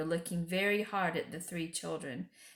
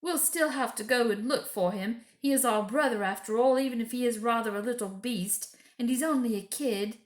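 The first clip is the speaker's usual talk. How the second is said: choking voice; 4 semitones higher, 6 dB louder than usual. A narrator's reading. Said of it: "in a rather choking sort of voice".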